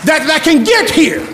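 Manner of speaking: impassioned pulpit delivery; fired-up preaching. Real, not acted. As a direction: preach it hard, pitch riding high. Preaches at full force.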